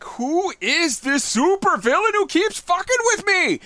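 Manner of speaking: high, loud, baffled cry; mock super-villain victim. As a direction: squeal it out, high and outraged. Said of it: "just so you're like".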